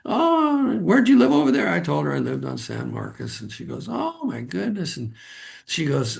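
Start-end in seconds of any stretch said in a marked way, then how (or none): 0.1-1.7 put-on much higher, eager old lady voice; lightly voiced chatty elderly woman; a hint of a delighted grandma; excited old lady. 3.9-5.0 higher, softer, slow "Oh, my goodness" drawn out; a lightly voiced old woman's hushed surprise; mock awed elderly gasp; amazed old lady. Old lady realising who he is.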